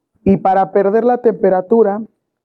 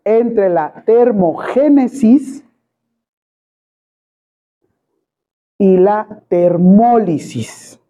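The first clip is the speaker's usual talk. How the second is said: very slow, word by word, for note-taking; slow dictation; much slower than usual. Shown as a demonstration. Teacher dictates the definition to students, very slowly. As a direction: spell out termogénesis and termólisis slowly. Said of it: "Es equilibrio".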